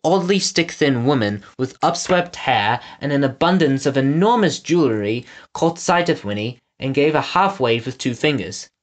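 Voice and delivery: plain reading voice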